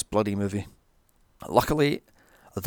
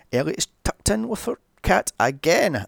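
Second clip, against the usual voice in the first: usual speaking voice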